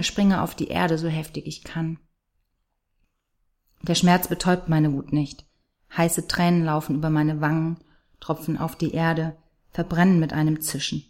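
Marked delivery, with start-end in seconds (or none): none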